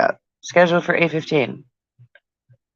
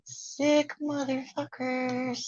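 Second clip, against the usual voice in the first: high slowed voice; 9 semitones higher, 11 dB quieter, much slower than usual. Pretend. Pitched voice effect.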